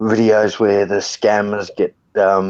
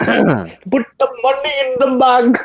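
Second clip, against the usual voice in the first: put-on very high, slow Indian-accented robber; mock Indian robber. Indian bank robber orders the teller, high comic accent. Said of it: "the Indian bloke that walks in and goes and robs the bank".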